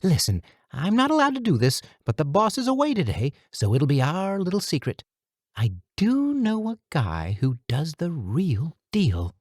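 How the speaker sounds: high nasal little-man voice; pinched, nasal, high, conspiratorial; nasally voice. A narrator's reading. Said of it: "in a nasally voice that belonged to a much smaller man".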